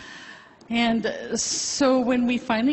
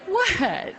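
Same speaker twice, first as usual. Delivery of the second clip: a much higher, laughing what; laughing surprise; 11 semitones higher than usual. Retold or imitated. Hearing the doctor's news, laughing surprise. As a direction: disbelief breaking into laughter.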